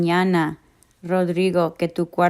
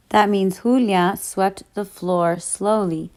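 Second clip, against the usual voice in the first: usual speaking voice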